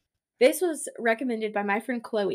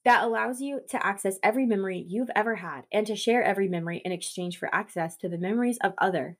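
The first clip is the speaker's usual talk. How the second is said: ordinary talk, nothing marked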